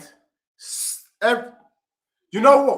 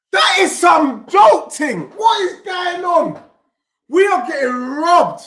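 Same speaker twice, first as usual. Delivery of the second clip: loud, higher, slow angry shouting; real slow furious shout; 6 semitones higher, 4 dB louder, slower than usual. Real, not acted. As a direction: rage at the decision. Goal chalked off.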